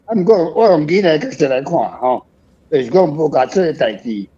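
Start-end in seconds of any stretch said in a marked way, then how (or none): none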